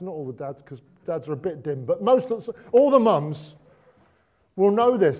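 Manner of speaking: usual speaking voice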